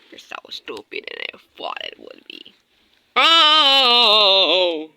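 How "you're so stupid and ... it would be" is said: low, soft whispered insult; whispered taunt. Pretend. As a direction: hiss the taunt under your breath. Whispered taunt during toy fight.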